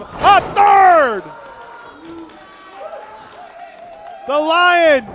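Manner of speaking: loud, high building hype call; excited wrestling commentator. Real, not acted. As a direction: roar out every strike of the comeback. Live wrestling play-by-play.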